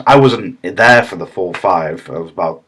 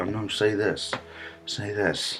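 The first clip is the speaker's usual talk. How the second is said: hushed, much softer prompt; silently; 14 dB quieter, slower than usual. Shown as a demonstration. Actor correcting a scene partner without ruining it, hushed. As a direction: slip it in under your breath. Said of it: "you know, silently".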